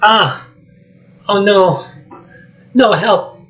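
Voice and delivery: high, panicky cries for help; Donkey screaming in fright at the ogre; freaking out. Acted. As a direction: yelp in terror at the monster. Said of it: "Donkey starts freaking out".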